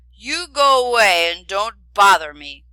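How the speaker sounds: loud grumpy dragon growl. A narrator's reading. Dragon tells the prince and princess to leave, loud growl. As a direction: shoo them off like a sulky beast. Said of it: "growled the dragon".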